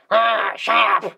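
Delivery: loud, high, drawn-out mock bellow; shouting sergeant impression. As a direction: bellow it like a dubbed anime sergeant. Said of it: "yeah, the, the sergeant".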